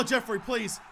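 ordinary talk

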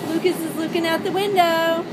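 ordinary talk, nothing marked

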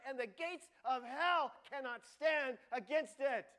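high, loud, thrilled exclamations; Jesus overjoyed at Peter's revelation, shouting with excitement; excited Jesus. Retold or imitated. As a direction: burst out with joy at Peter's answer. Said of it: "it says he gets really excited".

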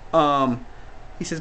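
normal talking voice

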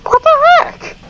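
very high squeak of shock; jolted shriek; startled. Real, not acted. Startled in horror game.

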